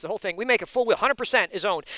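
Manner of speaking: ordinary talk, nothing marked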